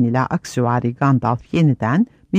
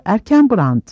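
higher-pitched cry of riders; excited shout; 6 semitones higher, 3 dB louder than usual. A narrator's reading. Riders hailing Erkenbrand, a moderately raised shout. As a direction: call out the name, pitched up. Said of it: "diye bağırdı süvariler".

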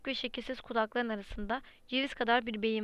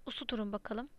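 ordinary delivery, nothing marked

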